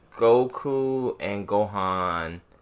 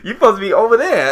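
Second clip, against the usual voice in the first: laughing; 11 semitones higher, 10 dB louder, faster than usual. Real, not acted. Joking reaction, laughing.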